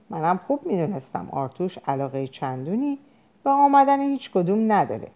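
usual speaking voice

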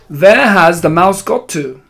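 loud, high, slow demanding question; Grand High Witch snapping. A narrator's reading. Grand High Witch hunting the mouse, angry demand. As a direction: demand it forcefully, stretching the words.

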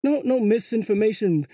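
ordinary talk, nothing marked

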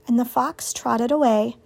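plain reading voice

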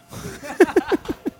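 high squealing tipsy laugh. Real, not acted. Tipsy laugh after a shot.

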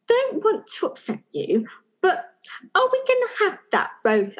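tiptoeing around asking for dinner; tentative husband. Retold or imitated. Husband cautiously asks about roast.

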